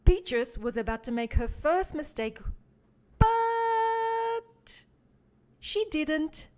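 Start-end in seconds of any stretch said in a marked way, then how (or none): 3.2-6.5 long high held "but"; drawn-out suspense. A narrator's reading. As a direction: stretch it out to build suspense. Dramatic pause before surprise.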